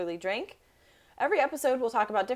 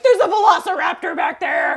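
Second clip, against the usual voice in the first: high, loud panicked yell; panicked shout; 10 semitones higher, 13 dB louder than usual. Pretend. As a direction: scream a warning in mock terror. Pretend panic on a hike.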